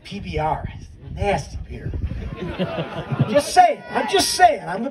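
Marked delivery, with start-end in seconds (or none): none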